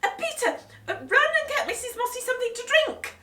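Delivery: high, loud, fast laughing flurry; flustered shout. Acted. Mum sending Peter for a drink, flustered loud. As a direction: cover the embarrassment, hurry him. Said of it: "shouted Mum".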